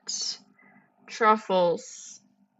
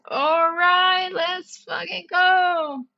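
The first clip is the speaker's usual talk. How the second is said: hyped up; 7 semitones higher, slower than usual. Real, not acted. Hyping up the puzzle.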